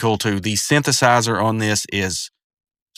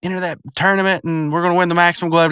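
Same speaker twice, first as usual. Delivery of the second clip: higher-pitched eager retelling; imitated eagerness, pitched up; 7 semitones higher, faster than usual. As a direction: play an excited gamer rallying a friend. Acting out Rigby's eager tournament plan.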